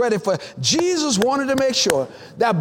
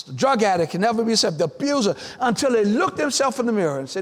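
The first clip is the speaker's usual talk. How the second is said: ordinary delivery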